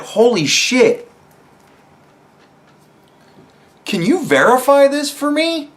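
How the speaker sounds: skeptical reporter. Retold or imitated. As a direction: play a curious journalist reaching for the phone. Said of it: "they went".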